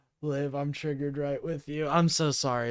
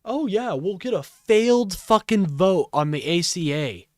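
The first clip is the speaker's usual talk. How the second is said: loud, higher sarcastic imitation; mock their naive confidence, hitting "failed fucking vote"; scornful mimicry, loud and raised; mocking quote; 5 semitones higher, 8 dB louder than usual. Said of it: "and said".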